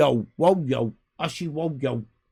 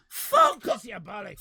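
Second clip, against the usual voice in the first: very high yelp of disgust; mock outburst; 9 semitones higher than usual. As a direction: blurt it at the clerk. Retorting to the clerk.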